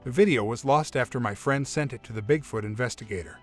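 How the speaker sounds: plain reading voice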